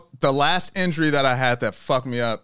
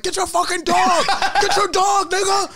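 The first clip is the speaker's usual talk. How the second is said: losing it, shouting. Retold or imitated. Yelling at the dog owners as the chihuahua charges, shouting. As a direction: yell at them like you've snapped. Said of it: "I'm just fucking losing it".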